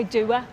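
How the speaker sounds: "doer" for door, in two syllables. Shown as a demonstration. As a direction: say "doer" as DOO-uh. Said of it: "we would say doer".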